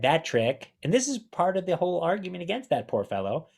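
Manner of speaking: usual speaking voice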